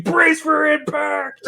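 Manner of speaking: high, drawn-out mock scream, held back in volume; imitated inner scream. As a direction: scream it inwardly, high and slow. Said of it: "internally you're screaming".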